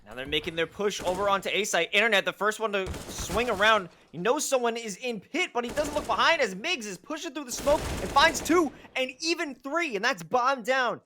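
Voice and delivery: building to high-pitched excitement; excited play-by-play. Real, not acted. Caster calling a push onto A site, building excited play-by-play. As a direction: call the action with mounting urgency.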